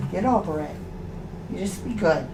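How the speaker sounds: ordinary delivery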